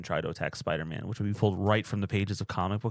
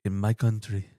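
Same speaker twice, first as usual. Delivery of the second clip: put-on Wakandan accent; 4 dB louder than usual. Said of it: "practice my Wakanda voice".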